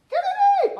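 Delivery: high, bright call of a name; excited call. Real, not acted. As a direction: call her name out with delight. Calling on a volunteer reader.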